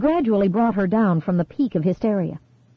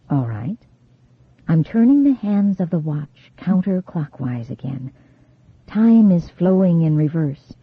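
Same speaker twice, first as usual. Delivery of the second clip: hypnotic low voice; 3 semitones lower than usual. A narrator's reading. Hypnotist leading regression.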